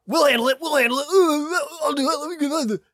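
frantic high-pitched loud babbling; hammed-up busybody, very high and loud; overeager meddler. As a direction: rush in squealing to take over. Acting out US rushing in.

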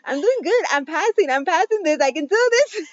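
mock proud new mom; giddy self-encouragement. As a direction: squeak it out like you're amazed at yourself. Retold self-pep talk holding baby.

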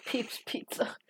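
breathy whispered name; whispering. Real, not acted. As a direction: whisper it in awe.

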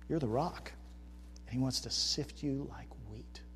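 ordinary delivery

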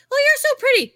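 high, loud fake-sweet compliment; mock a gushing, insincere compliment; surface nice. Two-faced person complimenting a girl, imitated high and fake-sweet. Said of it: "they're surface nice".

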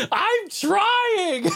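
very high squeaky imitation of the wife; wife laughing back. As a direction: squeak it way up, mock-flustered. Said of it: "And she's like".

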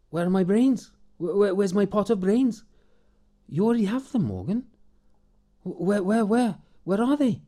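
high, stammering, eager asking; eager simple man. Acted. Morgan demands his pot of brains, high and stammering.

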